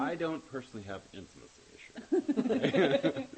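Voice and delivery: slow, cracking up with laughter; failing straight voice. Real, not acted. Answering a teasing question about himself, failing to keep straight. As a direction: try to say it straight while laughing. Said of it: "You try saying it with a straight voice".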